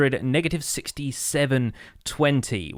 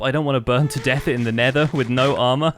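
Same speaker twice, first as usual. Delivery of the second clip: ordinary talk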